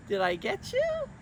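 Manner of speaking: very high playful tease; teasing. Acted. Miss Julie teasing the kids she tricked, high and playful. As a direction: catch them out gleefully.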